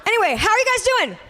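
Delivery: fast, high, perky check-in; quick crowd greeting. Pretend. Host changes subject to greet the room, quick high greeting. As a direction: toss it out quickly with a high lift.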